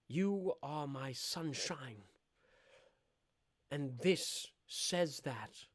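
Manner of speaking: put-on slow, hushed old-fashioned suitor; mock 1784 suitor. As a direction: woo her slowly like an eighteenth-century gentleman.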